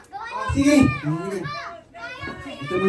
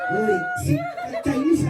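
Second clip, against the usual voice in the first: mock surprised yelp; 4 dB quieter than usual. Host teasing kid as Chinese.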